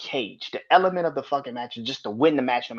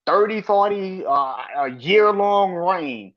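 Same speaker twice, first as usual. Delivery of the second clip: ordinary delivery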